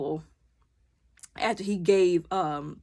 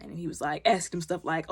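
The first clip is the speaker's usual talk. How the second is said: normal talking voice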